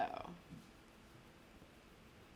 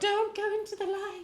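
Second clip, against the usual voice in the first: spooky ghost voice; 5 dB louder than usual. Acted. Joking spooky warning.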